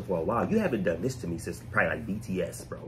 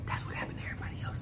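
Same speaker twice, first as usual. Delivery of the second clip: whispered aside; 10 dB quieter, faster than usual. Real, not acted. Host whispering to viewers about past first songs, hushed.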